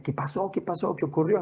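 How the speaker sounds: high-pitched, alarmed questions without real volume; mock yell, pitched high; retold frantic yelling. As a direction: imitate a panicked sister shouting from across the room. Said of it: "mi hermana empezó a gritar por allá".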